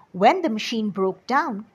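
ordinary delivery, nothing marked

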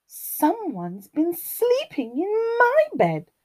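high, lilting mother bear voice; Mummy Bear. A narrator's reading. Mummy Bear finds her bed slept in, high lilting complaint. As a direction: pitch it high as a put-out mama bear. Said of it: "rumbled Mummy Bear".

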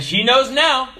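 the brother, proud of his revenge; cheeky little boy. Retold or imitated. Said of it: "Little brother said".

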